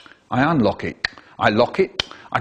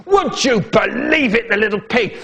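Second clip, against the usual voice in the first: higher, indignant dad voice; outraged dad; 7 semitones higher than usual. Acted. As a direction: play the shocked father. Dad's outraged voice.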